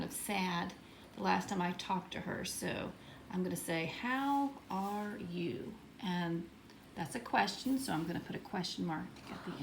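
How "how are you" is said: slow, word-by-word dictation; unhurried teacher sounding out a sentence; writing-out pace. Shown as a demonstration. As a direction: stretch each word as you write it out. Teacher sounds out letter while writing.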